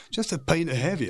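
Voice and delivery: insistent repeat. Retold or imitated. Scot repeating his order to a baffled barman, insistent. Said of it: "I remember saying to the barman".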